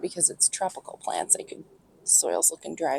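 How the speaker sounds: quiet unvoiced murmur; whispered aside. Real, not acted. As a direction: think aloud under your breath. Whispered aside while checking pot.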